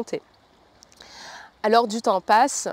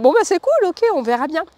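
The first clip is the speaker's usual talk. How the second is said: excited; 8 semitones higher, 8 dB louder than usual. Retold or imitated. Retold reaction to pendulum answer.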